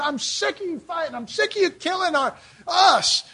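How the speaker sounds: high, strained retold outrage; imitated fed-up protest. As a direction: protest like a marcher facing down a gunman. Voices peace marcher confronting a gunman.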